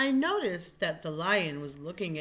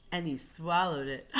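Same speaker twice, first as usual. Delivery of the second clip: ordinary delivery, nothing marked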